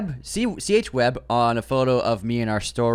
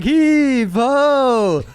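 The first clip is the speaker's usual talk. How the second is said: mock heave ho; 8 dB louder than usual. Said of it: "[name], stop saying".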